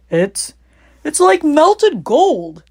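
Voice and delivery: slow, high breathless awe; gasping. Acted. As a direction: gasp it out in wonder. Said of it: "he gasped".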